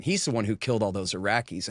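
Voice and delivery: ordinary talk, nothing marked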